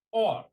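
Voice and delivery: "Or" said alone, loud and punched; stressed OR. Shown as a demonstration. Teacher flagging the important word to students, "Or" stressed loudly. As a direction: hit "Or" hard as the key word. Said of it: "this word becomes important".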